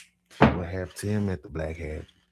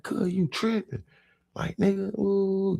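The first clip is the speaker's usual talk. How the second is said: exasperated yell; 3 dB louder, slower than usual. Retold or imitated. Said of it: "I'm like".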